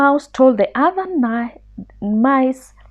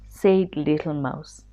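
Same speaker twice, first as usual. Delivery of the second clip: ordinary delivery, nothing marked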